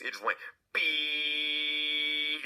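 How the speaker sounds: high, slowly stretched "beep" like a maxed-out alarm; long detector beep. Retold or imitated. The detector maxing out at the furnace, stretched high beep.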